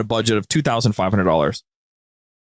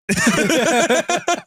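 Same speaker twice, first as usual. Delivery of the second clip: squeaky embarrassed laugh, rising; high burst of laughter. Real, not acted. Guest admits he bought the Garfield calendar, high laugh.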